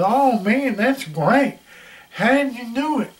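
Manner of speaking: high, delighted disbelief; excited praise, voice jumping up; amazed boss. Retold or imitated. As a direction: boss suddenly thrilled. Boss amazed by huge sales.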